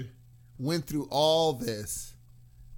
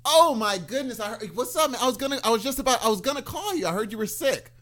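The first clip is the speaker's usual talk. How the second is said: gushing; 6 semitones higher, 4 dB louder than usual. Pretend. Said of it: "Are we role playing?".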